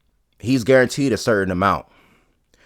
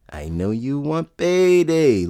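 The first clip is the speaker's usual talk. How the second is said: slow, drawn-out higher-pitched mimicry; Canelo; 5 semitones higher, slower than usual. Boxer telling a rival he wants payday, slow impression. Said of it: "that's Canelo".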